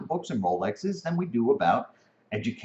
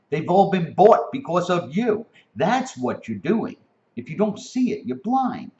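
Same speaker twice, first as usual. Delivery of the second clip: heated rant; 7 semitones higher, 5 dB louder than usual. Real, not acted. Angry rant at the kids.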